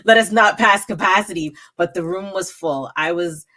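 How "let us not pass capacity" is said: mock prayer. Praying room won't overflow.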